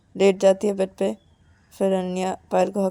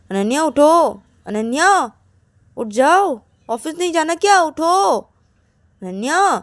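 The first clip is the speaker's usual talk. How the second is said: raised, loud wake-up calls; calling to wake someone; 6 semitones higher, 5 dB louder than usual. Acted. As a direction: rouse a sleeper for the office. Acted waking a sleeping partner. (ज़ोर से जगाते हुए)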